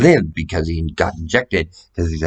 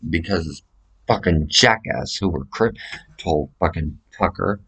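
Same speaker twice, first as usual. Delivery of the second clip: usual speaking voice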